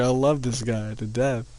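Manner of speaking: ordinary delivery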